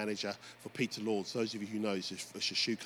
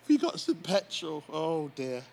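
ordinary talk, nothing marked

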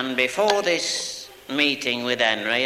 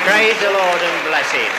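loud praise; 4 semitones higher, 7 dB louder than usual. Real, not acted. Testimony climax, exclaiming praise.

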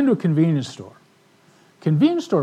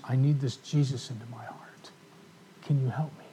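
hushed plea; 3 semitones lower, 10 dB quieter than usual. Retold or imitated. Woman on phone asking for help.

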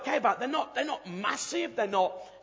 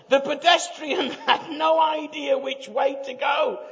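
laughing; 4 semitones higher, 6 dB louder than usual. Real, not acted. Reading funny claim, laughing.

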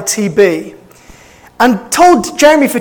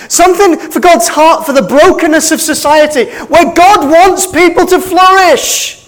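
full-force preaching; 5 semitones higher than usual. Real, not acted. Pastor preaching God's care for the poor, full force. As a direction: preach it out, building to a climax. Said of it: "Am I okay to preach like this?".

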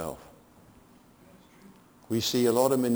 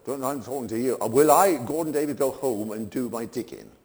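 ordinary delivery

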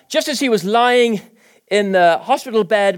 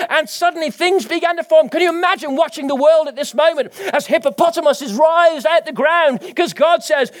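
full-force preaching; 7 semitones higher than usual. Acted. Preacher stirring the congregation, full-force preaching. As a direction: preach it out with conviction.

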